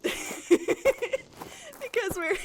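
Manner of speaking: cracking up mid-sentence; can't get the joke out for laughing. Real, not acted. Laughing through own joke.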